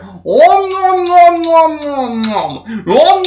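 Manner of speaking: high, slow exaggerated nom-nom chewing sounds; squeaky cartoon chomping; mock eating noise. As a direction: ham up a gross munching noise. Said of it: "they start making this noise".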